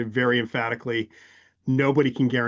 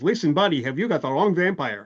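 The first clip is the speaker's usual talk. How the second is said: lightly voiced Yiddish accent vampire; a hint of a thick Yiddish accent; 4 semitones higher than usual. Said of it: "in a very thick Yiddish accent".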